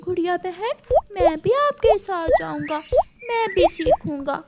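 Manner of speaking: little brother begging to come along; eager little brother. Acted.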